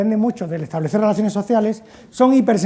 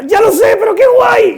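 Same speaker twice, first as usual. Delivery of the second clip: much higher and louder, thrilled yell; gleeful shout; 14 semitones higher, 9 dB louder than usual. Retold or imitated. Teen friend admitting the danger, shouting with glee. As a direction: shout it with reckless teenage glee.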